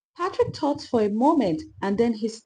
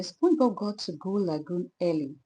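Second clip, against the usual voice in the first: usual speaking voice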